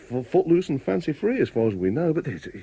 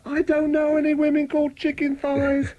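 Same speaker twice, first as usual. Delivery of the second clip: mock indignant; 12 semitones higher than usual. Real, not acted. Protesting he sends no messages.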